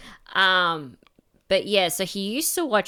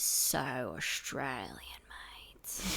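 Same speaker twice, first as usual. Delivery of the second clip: put-on Australian accent; 5 semitones lower, 11 dB quieter, much slower than usual. Mimics dad's broad Australian accent.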